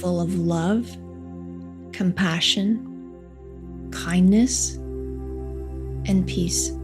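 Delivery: soft, slow meditation voice; guided meditation. Acted. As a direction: guide them gently into calm, unhurried. Leads a heart meditation.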